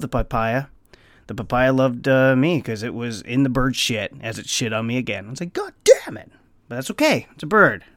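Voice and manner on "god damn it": God damn it pitched high in mock outrage; high mock-outraged God damn it; exasperated curse. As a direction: squeal it in exasperation. Bird shat on him again.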